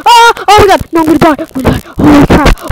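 real gamer panic; panicked yelling. Real, not acted. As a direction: scream as the mobs close in on you. Dying in game, panicking.

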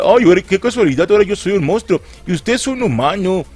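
lightly whiny, mocking child voice; Kiko's voice. The monster taunts Dracula, a touch of Kiko. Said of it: "suena así como si fuera Kiko".

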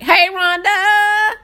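high, drawn-out hello; excited high-pitched greeting. Real, not acted. Streamer greeting a viewer, excited high drawn-out call. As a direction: call out the name high and long. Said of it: "my voice get high-pitched".

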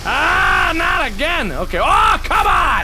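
high, dismayed protest; frustrated yelling. Real, not acted. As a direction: yell as the game beats you. Caught again in game.

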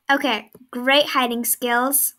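ordinary talk

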